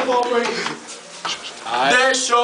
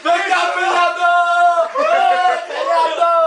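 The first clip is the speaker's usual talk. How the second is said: excited shouting; 3 semitones higher than usual. Real, not acted. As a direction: cheer him on wildly. Hyping friend in hotel. (gritando empolgado)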